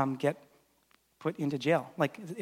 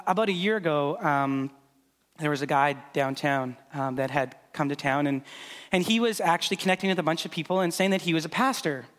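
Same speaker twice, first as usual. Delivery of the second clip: ordinary talk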